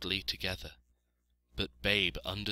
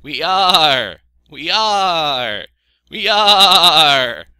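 high, very loud, stretched bleats; chorusing sheep; 9 semitones higher, 19 dB louder, much slower than usual. A narrator's reading. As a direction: bleat it over and over with gusto. Voices the flock.

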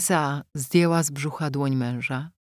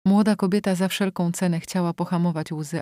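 plain reading voice

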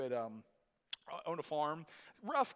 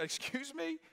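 incredulous; 9 semitones higher, slower than usual. Retold or imitated. Doctor incredulous.

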